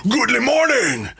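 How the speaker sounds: over-the-top, high-pitched exclamation; big theatrical greeting, pitched high; Al Pacino. Retold or imitated. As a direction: swoop way up like a hammy movie-star outburst. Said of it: "that kind of vibe from, from Al Pacino".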